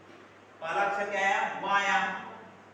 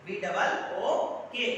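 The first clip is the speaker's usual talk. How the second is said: slow spelling out; much slower than usual. Shown as a demonstration. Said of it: "आगे देखते हैं B double O के".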